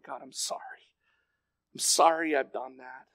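hushed, contrite prayer; a soft, barely voiced confession; broken apology. Preacher alone in his office confessing to God, hushed apology. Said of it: "it just destroyed me in my office".

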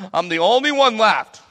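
sudden loud, high outburst; venting. Acted. Elijah venting to God, loud high outburst. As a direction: burst out in exasperation. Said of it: "He vents to God".

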